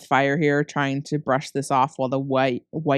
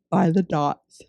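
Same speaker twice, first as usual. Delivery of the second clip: words stretched by a yawn; 2 semitones higher than usual. Real, not acted. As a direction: talk through a yawn. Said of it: "Pardon me for yawning into the microphone".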